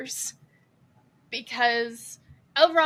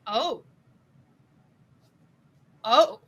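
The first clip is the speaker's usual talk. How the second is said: first level, then rising louder; dawning shock; 3 dB louder than usual. Retold or imitated. Reacting to the brutal battle, growing shocked surprise. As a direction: let the second OH climb in alarm. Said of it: "to where I was like".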